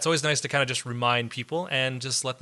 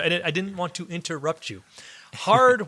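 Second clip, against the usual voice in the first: usual speaking voice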